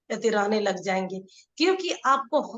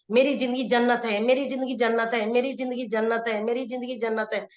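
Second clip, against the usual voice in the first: quick, rapid-fire repeats; fast affirmation; faster than usual. Shown as a demonstration. Coach shows the fast way that leaves no doubt, quickly. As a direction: rattle it off fast without a pause. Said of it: "अगर हम यही तेजी में बोल रहे हैं".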